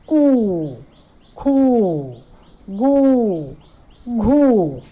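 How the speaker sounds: loud, long ऊ sounds for the class; drawn-out syllables. Shown as a demonstration. Teacher drilling the class, loud and drawn out. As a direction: stretch each कू खू गू घू. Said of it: "जैसे क पर बड़े ऊ की मात्रा बन गया".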